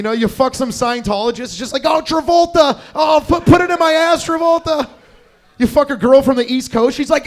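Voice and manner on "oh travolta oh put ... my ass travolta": high, shouted lover impression; loud, high parody of passion; mock ecstatic cries. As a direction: yell it in hammed-up ecstasy. Mimicking a lover's cries in sex.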